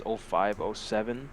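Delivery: ordinary talk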